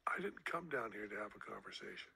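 put-on flat whispered teen retort; whispering. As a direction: whisper it, deadpan and curt. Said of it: "And Zoltan said".

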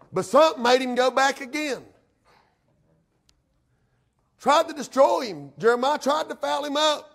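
high, ringing pulpit voice; impassioned preaching. Real, not acted. Preacher telling how Samson went back, impassioned high preaching. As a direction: lift the story into a preacher's cry.

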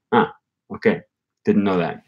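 ordinary delivery

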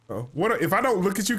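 ordinary delivery

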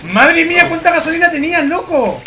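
high, loud amazed outburst. Real, not acted. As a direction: marvel at the haul. Amazed at loot found.

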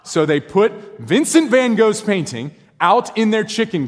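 laughing through the line; chuckling at how absurd it is; amused laugh while telling it. Real, not acted.

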